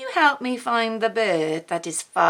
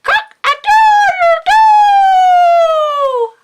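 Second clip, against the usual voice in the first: very high, loud crowing cock-a-doodle-doo; storyteller crowing like a cockerel; rooster crow; 12 dB louder, much slower than usual. Acted. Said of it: "All together".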